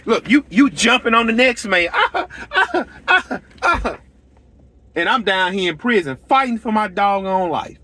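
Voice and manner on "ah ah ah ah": laughter. Real, not acted. Laughing at his own joke.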